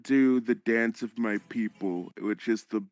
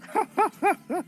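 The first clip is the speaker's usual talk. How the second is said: high laugh. Real, not acted. Player bursts out laughing.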